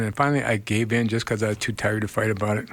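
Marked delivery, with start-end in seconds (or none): none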